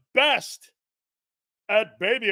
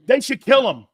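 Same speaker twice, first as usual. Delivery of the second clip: loud rant; 6 semitones higher, 8 dB louder than usual. Real, not acted. Heated media rant.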